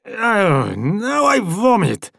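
put-on slow, high queasy Slavic accent; foreign accent. A narrator's reading. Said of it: "said the man with the foreign accent".

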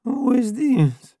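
high, slow just-woken voice; sleepy voice on the phone. A narrator's reading. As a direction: answer like you've just woken up. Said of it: "He heard a sleepy".

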